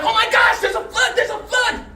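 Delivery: very high, loud panicky scorpion; comic high-pitched flood warning; mock panic. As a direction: shout a hammed-up alarm in a squeal.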